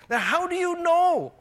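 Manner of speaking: very high, loud, exaggerated disbelief; hammed-up baffled outcry; incredulous challenge. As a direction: demand an answer in mock amazement. Retold challenge to missionary friend.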